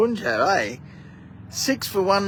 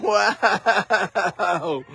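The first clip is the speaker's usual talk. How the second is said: a laugh that spills into an amazed wow; chuckle, then marvel at it; 2 semitones higher than usual. Real, not acted. Commentator seeing the batter skied again, laughing wow. Another lofted shot nearly caught.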